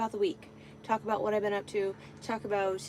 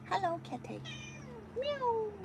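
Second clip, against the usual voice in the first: cat talk; 13 semitones higher, 8 dB quieter than usual. Real, not acted. Greets her cat.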